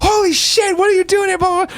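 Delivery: very high squealing fan voice; excited fan. Retold or imitated. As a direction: imitate a starstruck fan. Fan recognizes Greg on street.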